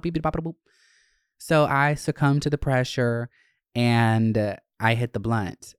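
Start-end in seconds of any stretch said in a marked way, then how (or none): 0.0-0.6 censor beeps. Pretend. Censoring herself with beep sounds.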